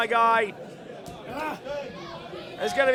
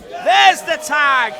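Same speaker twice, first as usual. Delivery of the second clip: louder, punchy call of the tag; excited call; 8 dB louder, slower than usual. Real, not acted. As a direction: hit the tag call hard. Play-by-play, big moment.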